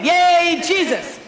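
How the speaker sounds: joyful cheer. Shown as a demonstration. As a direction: shout it out like on the playground. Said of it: "Now shout".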